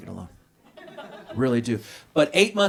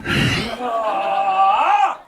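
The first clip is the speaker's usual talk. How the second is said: mock wailing cry; 4 semitones higher than usual. Wife crying after his reaction.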